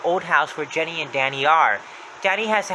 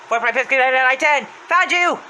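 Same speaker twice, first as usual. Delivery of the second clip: rapid high shouted count; Happy's voice; 10 semitones higher, 6 dB louder than usual. Retold or imitated. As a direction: rattle off the count loudly, then pounce on the find. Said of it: "Happy heard her footsteps".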